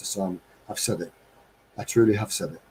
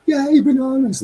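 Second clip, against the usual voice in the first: much higher, louder, squealing chimp joy; excited chimp; 7 dB louder than usual. Retold or imitated. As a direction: squeal like a monkey seeing bananas. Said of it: "the zookeeper arrives and it's like".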